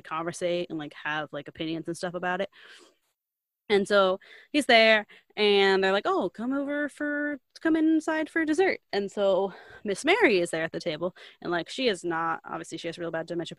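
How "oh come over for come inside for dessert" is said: high, put-on hospitable call; inviting hosts. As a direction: imitate hosts ushering a guest in for dessert. Acts out family inviting him inside.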